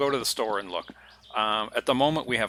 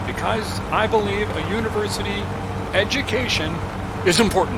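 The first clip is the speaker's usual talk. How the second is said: Bernie Sanders impression; 9 semitones higher, 5 dB louder than usual. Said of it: "Bernie Sanders cadence impersonation".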